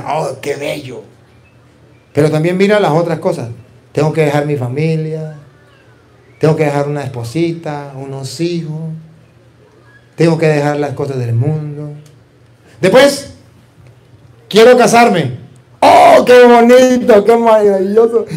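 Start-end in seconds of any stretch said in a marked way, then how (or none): 0.0-1.0 dreamy sigh. Pretend. Imagining a priest's life. 15.8-18.3 dreamy delight. Pretend. Imagining married life.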